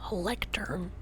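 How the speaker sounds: hushed whisper. Real, not acted. Showing Pixel a lectern, whispered. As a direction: breathe the word lectern, no voice. Whispered then repeated aloud.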